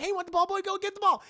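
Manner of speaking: very high, loud pet-talk voice; talking to a dog. Retold or imitated. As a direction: excitedly coax a dog to fetch.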